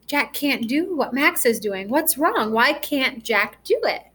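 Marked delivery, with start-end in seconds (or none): none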